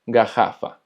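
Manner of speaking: garrafa said "ga-HA-fa", the rr as a throaty h; Portuguese double R. Shown as a demonstration. Teacher modelling double R for students, throaty h. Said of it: "se va a escuchar como una J".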